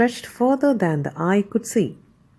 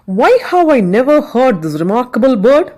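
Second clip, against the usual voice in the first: higher, louder, commanding emperor voice; a demanding emperor, amazed; emperor asking; 4 semitones higher, 6 dB louder, faster than usual. A narrator's reading. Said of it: "he asked".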